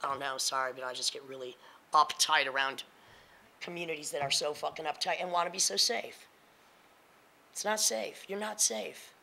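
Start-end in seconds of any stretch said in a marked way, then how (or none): none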